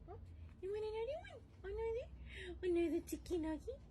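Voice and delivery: high, loud sing-song offer to a dog; pet talk. Real, not acted. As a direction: tempt the dog with a treat. Offering dog another treat.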